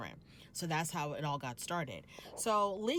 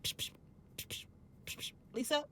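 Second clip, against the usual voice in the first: whispered psh sounds, name rising higher; hushing whisper; 9 semitones higher, 7 dB quieter, faster than usual. Retold or imitated. Mary hushing Lisa at the table, whispered. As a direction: hiss her quiet in a whisper.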